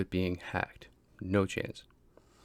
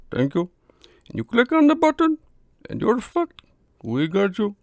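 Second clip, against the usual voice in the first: much higher, louder mock Indian scammer accent; hammed-up Indian call-centre scammer, pushy and urgent; exaggerated Indian accent, squeaky and loud; horrible Indian accent; 12 semitones higher, 7 dB louder than usual. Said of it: "It's a horrible accent".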